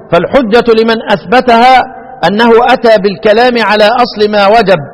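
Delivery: normal talking voice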